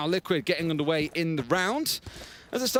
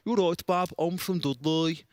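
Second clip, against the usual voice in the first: Dudley Birmingham accent with the oi ending, ending slow; slower than usual. Shown as a demonstration. Explaining Birmingham speech to his co-caster, a mild Dudley Birmingham accent. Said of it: "example of what a Birmingham accent sounds like".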